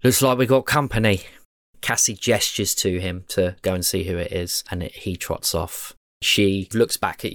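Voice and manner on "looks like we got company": lightly voiced henchman, higher and louder; a hint of an eager lackey calling to the boss; a light henchman voice reporting visitors to the boss; henchman Weege. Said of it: "And he says".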